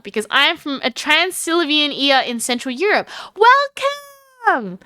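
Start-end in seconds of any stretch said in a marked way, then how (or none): 3.4-4.8 pitched way up, ringing "Welcome!"; excited welcome. Real, not acted. Streamer greeting a Transylvanian viewer, excited welcome. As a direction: greet the newcomer with a big bright call.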